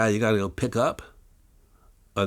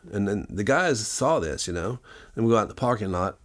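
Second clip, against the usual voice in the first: usual speaking voice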